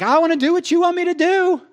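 high, loud whining insistence; whiny plea. Retold or imitated. Pastor mocking himself whining to God, high and loud. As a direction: whine it like a child to a parent.

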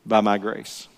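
low, soft voice of divine reassurance; God. Acted. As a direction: drop low and quiet, letting the last words land after a pause. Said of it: "it was like this voice of God says".

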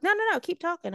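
high-pitched mock teacher insistence; imitating a sarcastic teacher; goading teacher. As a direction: egg the student on in a high voice. Retold teacher goading the student again.

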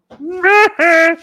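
high, loud, forced laugh; sustained high silly cackle; mocking cackle. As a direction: burst into a piercing mock laugh. Mocking laugh after big bang rant.

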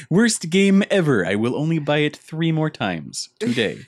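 hammed-up cartoon nerd voice; Comic Book Guy. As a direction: pronounce a verdict like a snobby nerd. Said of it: "with comic book guy voice".

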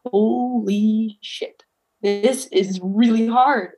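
very slow, drawn-out words; slow, awed realization; stunned disbelief. Retold or imitated. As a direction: stretch it out in retold disbelief. Retells reaction to the hard stretch.

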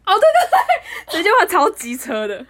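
loud, slow laughing reply. Real, not acted. Laughing at her own rude remark.

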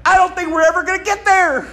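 high, whining despair at the wait; exasperated whine. Retold or imitated. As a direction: sigh it out, losing hope. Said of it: "and you're like".

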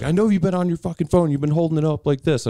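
angry cop. Retold or imitated. Retelling the cop's order to the driver, mock angry. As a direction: bark it like a furious cop.

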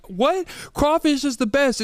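high indignant crawfish lover; mock outraged fan. Mocking a crawfish fan who disagrees, indignant high voice. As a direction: squeal it like an offended fan.

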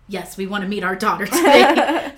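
loud burst with laughter in it; imitating an eager, laughing yes; eager acted reply. Acts husband's eager answer to interpreter.

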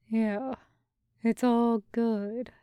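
lightly voiced dazed boyfriend, slow and breathy; slow, breathy, distant. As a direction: drift off in a breathy daze. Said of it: "he says slowly, voice coming out breathy and distant".

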